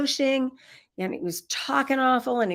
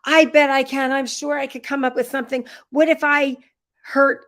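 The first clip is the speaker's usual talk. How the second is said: put-on taunting kid; 5 semitones higher, 6 dB louder than usual. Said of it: "And he looked up and he said".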